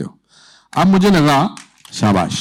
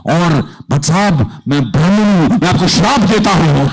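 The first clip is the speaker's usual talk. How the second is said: rising, louder dramatic curse, half-hammed; building from firm to a grand, louder curse; mock curse; 5 semitones higher, 3 dB louder than usual. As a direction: deliver it like a theatrical curse from an angry Brahmin. Retold curse on the director.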